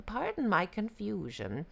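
very high yippy jackal voice; feigned confusion, excited; sly yippy jackal. Acted.

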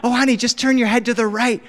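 put-on dad urging a small child to look; encouraging with rising urgency; coaxing parent. Dad coaxes daughter to look.

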